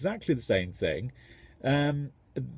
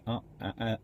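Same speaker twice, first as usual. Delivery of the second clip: ordinary talk, nothing marked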